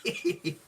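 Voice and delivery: very high little squeal of laughter; short, high-pitched giggle. Real, not acted. Host after his own impression, high giggle.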